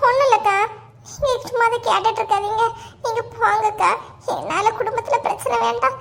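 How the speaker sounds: high, pitiful put-on protest; fake tearfulness. Sending the neighbour away from family trouble, fake tearfulness. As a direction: play the suffering victim through mock tears. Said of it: "அழுத மாதிரி இருக்கேன்".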